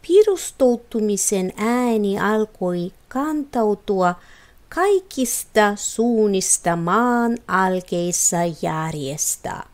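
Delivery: ordinary delivery, nothing marked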